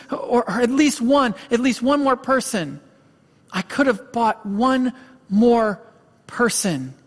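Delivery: imitated despair, high and faltering; retold anguish, bargaining for a single life; distraught breakdown. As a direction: plead high, then drop on the final word. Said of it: "he breaks down and he's crying".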